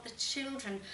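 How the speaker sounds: plain reading voice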